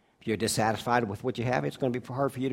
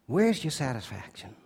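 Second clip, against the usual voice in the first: ordinary talk, nothing marked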